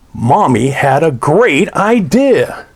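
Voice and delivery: ordinary delivery, nothing marked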